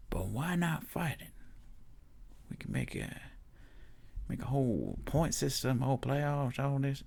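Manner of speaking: lightly voiced eager pitchman; a hint of a salesman hyping a season format; Ray Sefo pitching. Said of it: "Ray Sefo doesn't talk like that".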